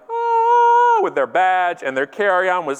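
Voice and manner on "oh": long, very high, loud ohhh; hammed-up showy fanfare cry; grand entrance "ohhh". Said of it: "They walk in like".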